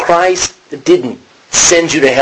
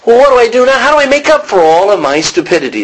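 desperate Paul; 7 semitones higher than usual. Retold or imitated. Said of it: "is what he's asking".

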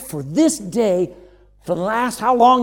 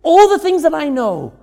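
Job crying out, higher and louder; sudden cry of despair; anguished cry; 8 semitones higher, 9 dB louder than usual. Retold or imitated. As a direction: let it burst out of Job. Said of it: "He says".

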